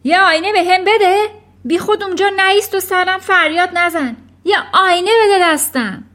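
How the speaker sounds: high, loud, rapid demand; agitated father yelling fast; urgent shouting. A narrator's reading. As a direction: bark a frantic order. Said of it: "پدر فریاد زد".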